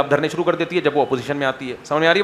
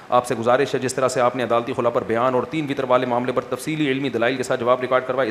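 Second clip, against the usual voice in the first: lower, even read-out; reading question aloud; 5 semitones lower, 5 dB quieter than usual. Real, not acted. Mufti reads out a listener's complaint, low formal read-out. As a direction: read the written complaint evenly, in a lower pitch.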